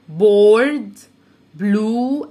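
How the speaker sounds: بورد loud with a long او; loud review. Shown as a demonstration. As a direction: boom بورد. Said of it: "review word". (بورد بصوت عالي)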